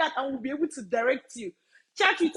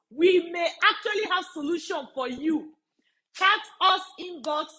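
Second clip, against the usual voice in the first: normal talking voice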